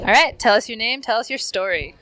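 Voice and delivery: loud, punchy host intro; announcer call. Real, not acted. Host hyping the next guest, loud announcer call.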